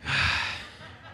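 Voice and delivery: slow breathy exasperated sigh out through the nose; a deep exhale at a chatty friend; long weary sigh. Shown as a demonstration. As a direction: let out a long-suffering sigh. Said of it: "they make you do that?".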